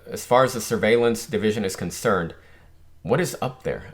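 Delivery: ordinary talk, nothing marked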